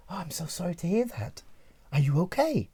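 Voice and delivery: soft, caring concern; sympathetic. Acted. Answering the upset colleague who needs compassion, sympathetic.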